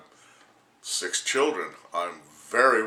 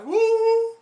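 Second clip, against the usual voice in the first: loud high "woo" hoot; 8 dB louder than usual. Real, not acted. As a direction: hoot like a loon. Crazy hoot.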